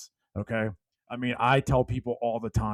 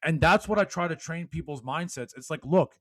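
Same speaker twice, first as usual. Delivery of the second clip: loud, high motivational speech; fired-up preaching; 4 semitones higher, 4 dB louder than usual. Acted. Speaker rallying podcast listeners, loud preaching. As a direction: preach it to the whole room.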